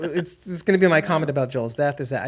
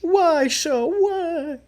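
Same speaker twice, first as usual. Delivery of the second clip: high wailing why; mock anguish; 13 semitones higher, much slower than usual. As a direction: wail at the show, hurt.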